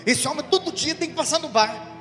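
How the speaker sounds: parishioner's wife venting to the priest about her husband; angry wife. Retold or imitated. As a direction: rant like a fed-up old wife. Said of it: "ela tava meio brava".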